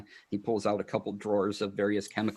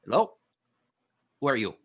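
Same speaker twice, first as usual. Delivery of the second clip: gnome voice; 2 semitones higher, 4 dB louder, much faster than usual. Acted. Gnome peers out door, voiced.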